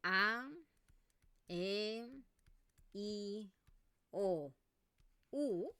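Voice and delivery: "A, E, I, O, U" each vowel said slowly and separately with pauses; name the five vowels one by one, clearly apart; vowels spelled out. Shown as a demonstration. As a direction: cada vocal por separado. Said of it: "tenemos cinco vocales".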